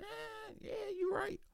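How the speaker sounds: very high, soft, timid agreement; meek squeak. Retold or imitated. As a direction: give in with a tiny squeaky voice. Meek reply to brother's certainty.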